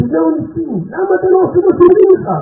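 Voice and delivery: hammed-up scolding yell; retold yelling. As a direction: imitate the rabbi scolding by calling out. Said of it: "אני צועק צעקות".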